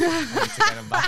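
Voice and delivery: laughing while talking; giggling through the words. Real, not acted. Laughing, mock revenge.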